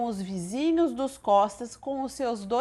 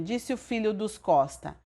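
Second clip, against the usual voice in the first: plain reading voice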